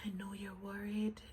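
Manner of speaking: hushed imitation of the doctor; doctor quoted in a whisper; whispered quote. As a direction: breathe it out as a whisper. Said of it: "he's like, he's like".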